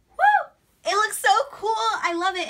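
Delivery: loud, very high whoop of delight; joyful whoop. Real, not acted. Vlogger whooping as her lights switch on. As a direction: shout for joy as the lights turn on.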